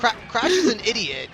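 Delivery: laughing retake of Crash is an idiot. Real, not acted. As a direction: repeat it laughing.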